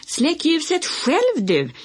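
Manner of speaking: slow, high, drawn-out yell back; shouted retort. A narrator's reading. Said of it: "skrek Olle".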